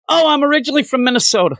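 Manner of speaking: quick, high-pitched retold excuse; rushed, high mock self-quote; awkward blurt. Retelling his awkward blurted reply.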